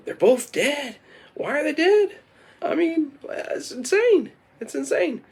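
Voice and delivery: very high, distressed squeal; mock distraught. Farmer lamenting two dead friends, distraught high voice. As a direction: wail it in high-pitched disbelief.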